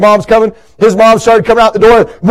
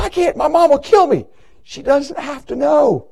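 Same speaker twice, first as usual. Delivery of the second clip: put-on hushed kid voice; 4 semitones higher, 8 dB quieter than usual. Hushed kids at checkout.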